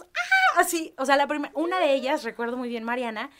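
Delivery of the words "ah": high, loud startled scream; imitating a scream of fright; group scream. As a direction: shriek in sudden fright. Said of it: "Y todos".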